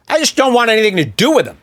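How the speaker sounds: mock pundit. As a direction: rattle it off like a smug commentator. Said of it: "They all talk like this now".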